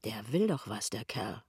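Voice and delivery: low, soft suspicion to himself; quiet wary aside; inner thought. A narrator's reading. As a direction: think it privately, dropped in pitch and volume. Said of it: "denkt Pinneberg".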